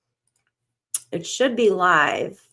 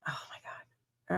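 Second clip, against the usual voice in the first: breathless whispered gasp; barely voiced, stunned whisper; 16 dB quieter, slower than usual. Real, not acted. Hushed reaction while checking news.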